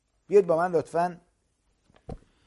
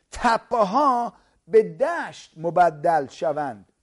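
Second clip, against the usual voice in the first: each word drawn out and louder; slow emphatic preaching; 6 dB louder, much slower than usual. Acted. Preacher explaining the valley to listeners, slow and emphatic. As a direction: lean on every word for the crowd.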